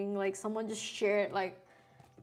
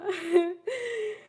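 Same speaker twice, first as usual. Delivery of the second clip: high giggle; 11 semitones higher, 4 dB louder than usual. Real, not acted. Laughs at chat.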